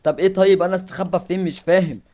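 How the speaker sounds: loud, high, frantic yelling; panicked shout. Real, not acted. Gamer panicking as the monster arrives, shouting loud and high. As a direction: scream it out as the monster closes in.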